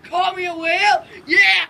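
loud gloating yell; triumphant shouting. Real, not acted. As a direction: bellow it in triumph. Brother taunting triumphantly.

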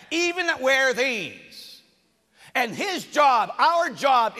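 loud, high-pitched sermon delivery; forceful preaching. Real, not acted. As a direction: drive each point home to the congregation.

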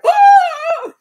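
very high, loud squeal of delight; starstruck squeal. Retold or imitated. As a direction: let out a thrilled fan shriek. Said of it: "but inside, you're like".

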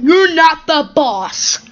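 loud, high, drawn-out defiance; angry retort. Acted. Gordon defies Scarlet's warning, angrily. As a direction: push back slowly and loudly.

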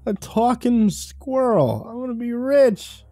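put-on high, slow, dreamy drunk voice; a drunk dreaming of riches; greedy drunk.

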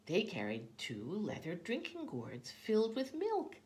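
ordinary delivery

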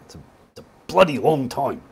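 lightly put-on British accent, self-mocking; a quick, half-joking British twang; mock British accent, dropped in for a laugh; bad British accent. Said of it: "that was a bad accent".

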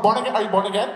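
quick "born again" with no breath between; run together as one word. Retold or imitated. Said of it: "Like, it's one word".

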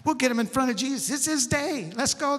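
eager buddies rallying, pitch climbing; rally the friends with growing urgency; fired up. Acted. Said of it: "they got all fired up".

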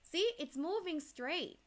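usual speaking voice

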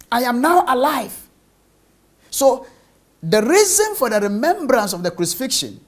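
ordinary talk, nothing marked

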